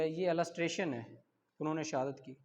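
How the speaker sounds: normal talking voice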